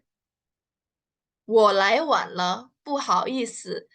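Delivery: stretched syllable by syllable, very slow; very slow drill read. Shown as a demonstration. Teacher repeats the line very slowly so students follow. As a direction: drag each syllable out for learners.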